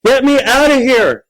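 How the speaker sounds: loud, high, urgent shout. A narrator's reading. Monster stuck in costume pleads, shouting. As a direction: shout desperately to be freed. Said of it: "he shouted".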